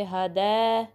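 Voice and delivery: हदै ending in a flat open eh vowel; हदै with no diphthong; wrong ya leen. Shown as a demonstration. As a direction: hold eh in हदै without gliding to ee. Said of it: "जो wrong वेज हैं".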